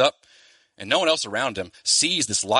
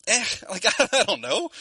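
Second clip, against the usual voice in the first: high-pitched, hesitant hemming and hawing; mock-reluctant squeak of doubt; reluctant hedging; 11 semitones higher than usual. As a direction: wriggle out of it in a much higher voice. Imagined reluctant reply to offer.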